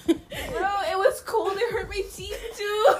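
high, breathless laughing, words spilling out. Real, not acted. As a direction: laugh it off after choking. Choked on water, laughing.

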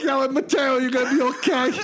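high-pitched, laughing call; mock concern. Friends calling to Mateo, mock concerned.

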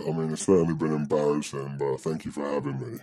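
usual speaking voice

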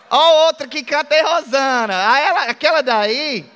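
amused laughing aside. Real, not acted. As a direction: tease her while laughing. Teasing a singing church member.

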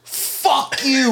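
very high, strained mock scream; comic high-pitched yell; retold scream. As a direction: scream back at a heckler, replayed. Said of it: "I'm just screaming at him".

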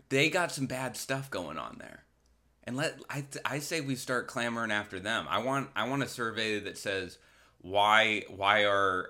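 ordinary delivery

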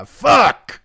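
high, loud yelled curse; real gleeful shout; triumphant shout. Real, not acted. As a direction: yell it out in glee. Triumphant yell ending the bit.

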